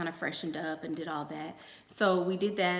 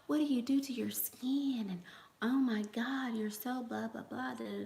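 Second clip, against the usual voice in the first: gushing admirers; 5 semitones higher, 3 dB quieter than usual. Retold or imitated. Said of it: "Everybody was saying".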